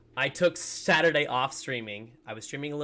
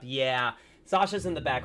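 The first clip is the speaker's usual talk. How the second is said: ordinary delivery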